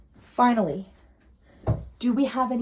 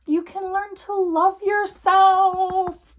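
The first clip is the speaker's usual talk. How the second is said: tender plea; 8 semitones higher, 5 dB louder, much slower than usual. Real, not acted. Tarot reader moved, urging viewer.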